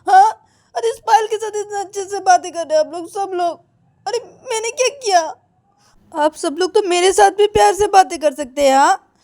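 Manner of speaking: high, loud tearful whine; whiny crying. Acted. Girl sulking at dinner, high tearful whine. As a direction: whimper like a sulking girl left out.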